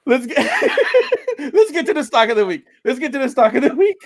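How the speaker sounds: high, fast, through laughter; pushing words out while laughing. Real, not acted. Laughing fit, trying to move on.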